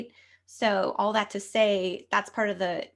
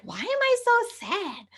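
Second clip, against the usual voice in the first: high, tearful whispered "Why am I so sad?"; crying whisper. Retold or imitated. Bewildered before a reading, retelling her teary high whisper. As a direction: whisper it through tears, much higher. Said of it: "I started to cry".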